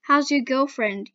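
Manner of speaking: plain reading voice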